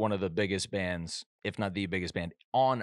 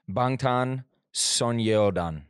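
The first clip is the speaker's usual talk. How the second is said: put-on Korean accent; much slower than usual. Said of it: "Why say it again in the accent?".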